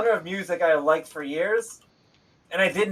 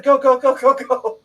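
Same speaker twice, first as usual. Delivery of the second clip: higher, louder rapid-fire "go" chant; rallying leader; 8 semitones higher, 7 dB louder, slower than usual. Retold or imitated. Player imitating the party's inspirational leader, rallying shout. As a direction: urge the troops onward like a leader. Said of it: "inspirational leader telling you to go".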